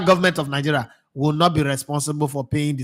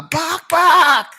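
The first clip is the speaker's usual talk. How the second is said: pitched way up, hammering the warning; high insistent warning; 12 semitones higher, 4 dB louder than usual. Retold or imitated. Pundit recalls warning the federation, high and emphatic. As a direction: insist hard on it. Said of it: "when I tell una".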